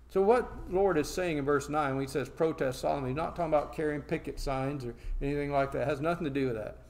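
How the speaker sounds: usual speaking voice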